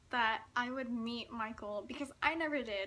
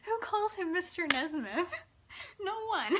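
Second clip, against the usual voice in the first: laughing at herself; 7 semitones higher, 4 dB louder than usual. Real, not acted. Laughing at her own gaffe.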